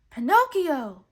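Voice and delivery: loud high joyful cry; cried out. A narrator's reading. Geppetto finding his son in his net, loud joyful cry. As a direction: cry out his name in disbelief. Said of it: "cried the woodcarver".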